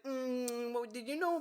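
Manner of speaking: high, mocking girl voice; mocking imitation. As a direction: mimic her prissy question.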